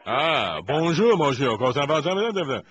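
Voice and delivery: mock French greeting. Greeting the Sierra Leone caller in mock French. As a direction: greet him in hammed-up French.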